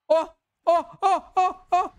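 string of high, loud "oh"s; short high cries of disbelief; excited gasps. Real, not acted. Kill count rising to five.

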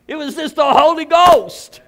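loud ecstatic proclamation; proclaimed. Real, not acted. Scene: preacher to congregation, loud proclamation. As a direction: call it out with full force.

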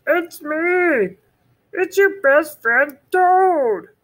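high, loud Toad calling. Acted. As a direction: shout it across the river. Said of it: "he shouted".

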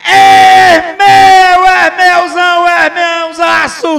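loud, slow, stretched goal call; explosive goal commentary. Real, not acted. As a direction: roar the goal, drawing out every word.

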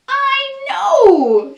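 high, bright little monkey voice, far above the narration; clever little monkey. Acted. The little monkey with an idea, high squeaky voice. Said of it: "says one little monkey".

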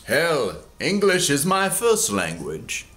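ordinary delivery, nothing marked

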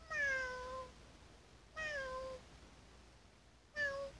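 high-pitched kitten-like mewing; imitated cat meow. Shown as a demonstration. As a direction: mew like a cat for a guessing game. Imitates cat for guessing game.

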